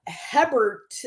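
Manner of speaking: "Hebert" as HEB-ert, short first vowel; mispronounced surname. Retold or imitated. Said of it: "there was all these different versions".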